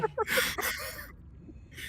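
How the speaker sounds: slowly, each word stretched out; slow and drawn out. Shown as a demonstration. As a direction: drag it out slowly.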